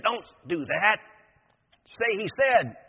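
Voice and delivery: protesting. Acted.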